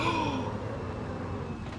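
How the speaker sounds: long, sharp intake of air; startled gasp. Real, not acted. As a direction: gasp mid-sentence. Startled while hunting for Zach.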